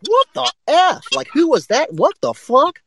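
high, drawn-out what-the-hell; baffled and incredulous; high incredulous outburst; stunned disbelief. Retold or imitated. Contestants stunned after judge bolts.

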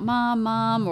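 retold panicked calling, lightly acted; imitating kids calling out. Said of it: "They were calling out".

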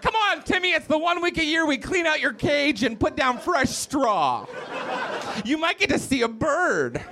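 high, coaxing parent voice; mock cheery parent. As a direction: coax a child with hammed-up excitement. Voicing parent of caged child.

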